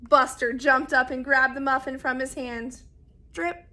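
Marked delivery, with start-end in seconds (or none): none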